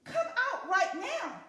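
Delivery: loud, high angry command; angry order. Acted. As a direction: order him out, fuming. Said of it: "And the man was angry".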